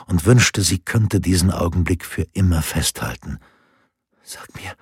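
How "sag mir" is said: breathless whispered stammer. A narrator's reading. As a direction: choke out the words barely audible. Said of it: "stammelte sie". (gestammeltes Flüstern)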